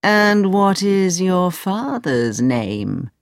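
put-on slow, probing old man's question. Old man questions Aladdin about his family, slow old man voice. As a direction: ask it slowly, drawing out each word. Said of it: "The old man stroked his beard".

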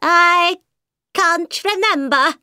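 high, slow hatter; high nervous hatter; Mad Hatter voice. A narrator's reading. Poor Hatter answers.

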